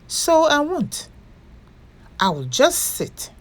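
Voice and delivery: gentle, lively storybook read-aloud; picture-book narration for little listeners; reading to children. A narrator's reading. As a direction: tell it like bedtime story time.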